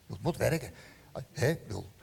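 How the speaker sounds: usual speaking voice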